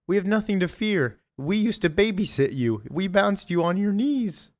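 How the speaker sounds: high-pitched put-on woman's voice; Mary Jane. Aunt appeals to nostalgia with an attacker, high imitated voice. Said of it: "none other than Mary Jane".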